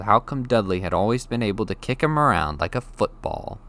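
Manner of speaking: usual speaking voice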